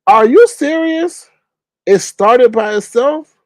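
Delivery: high, loud, slow disbelief; stunned, drawn-out are you serious; shocked disbelief. Real, not acted. Shocked reaction to comment.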